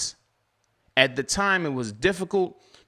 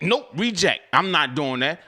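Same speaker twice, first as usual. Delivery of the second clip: usual speaking voice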